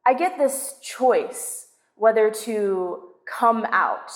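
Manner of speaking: plain reading voice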